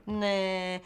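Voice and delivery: ordinary talk, nothing marked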